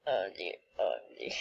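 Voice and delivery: soft unvoiced whisper. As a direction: breathe it out fast. Whispering while trolling players.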